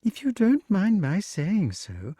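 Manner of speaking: a touch of high snake voice; polite snake. A narrator's reading. Snake turns to Wart politely, lightly high. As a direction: begin a polite remark.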